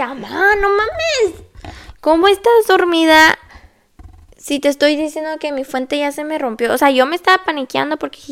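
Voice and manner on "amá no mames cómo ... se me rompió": high, loud disbelieving yell; can't believe she's still asleep; exasperated yell. Retold or imitated. Scolding her sleeping mother, exasperated yell.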